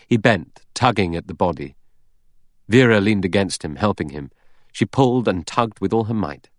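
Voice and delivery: ordinary delivery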